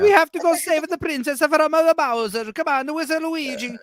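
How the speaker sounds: sing-song Mario impression. Mocking two hours of Mario's accent in a high voice. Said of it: "talking for two hours like that".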